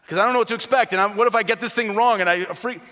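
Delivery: high, loud fretting; acted anxious worry. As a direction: voice a worrier spiralling into mild panic. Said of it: "Christians are even afraid to die".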